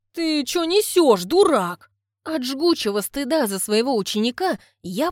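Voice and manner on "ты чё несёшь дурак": higher, louder, slow indignant shout; a woman furious at insulting compliments, shouting; outraged. Acted. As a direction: call him a fool loudly. Lyuda outraged.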